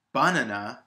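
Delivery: "banana" said as BAH-nuh-nuh; stress on first syllable. Shown as a demonstration. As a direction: hit the first syllable of "banana", weak after. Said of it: "put the stress on the first syllable".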